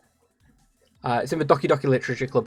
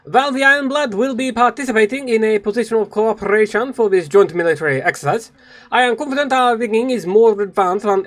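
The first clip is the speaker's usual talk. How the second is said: higher-pitched, formal military commander; Bismarck reading a speech; 7 semitones higher, 3 dB louder than usual. Acted. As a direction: deliver a proud, measured military briefing.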